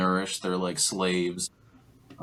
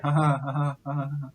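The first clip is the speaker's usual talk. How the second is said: imitated laughter; 6 semitones higher, faster than usual. Recapper mimics young ape joining the laughing crowd, high mock laughter. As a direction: fake a high, tittering laugh. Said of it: "everybody else is laughing".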